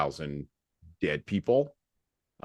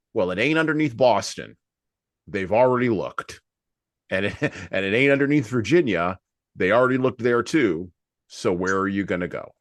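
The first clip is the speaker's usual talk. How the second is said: wry sarcasm; 4 semitones higher, 7 dB louder than usual. Real, not acted. Sarcastic aside on gold.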